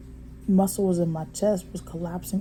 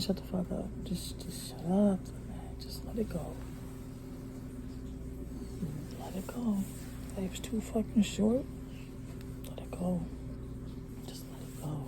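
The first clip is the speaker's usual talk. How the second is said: hushed, fading self-talk down to a whisper; calming herself; 14 dB quieter than usual. Real, not acted. Telling herself to let the anger go, hushed whisper. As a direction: talk yourself down, softer each time.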